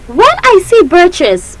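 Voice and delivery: high, slow recital start; leading a recitation. Shown as a demonstration. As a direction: lift the line for the class to follow. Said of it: "can you recite with me".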